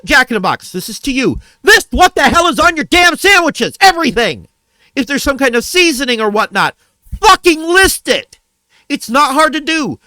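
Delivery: furious shout at Jack in the Box; real shouting rant. Real, not acted. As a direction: rant hard at the restaurant chain. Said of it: "I know I just yelled".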